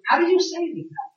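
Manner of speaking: hammed-up nitpicker; mock Pharisee. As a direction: play a fussy rabbi checking wording.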